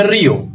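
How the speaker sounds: RÍO in two syllables, the í stressed; "río" with a hiatus, strong í then weak o. Shown as a demonstration. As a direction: say "río" as RÍ-o, leaning on the first vowel. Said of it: "Ri es una sílaba o es otra".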